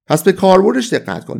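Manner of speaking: ordinary talk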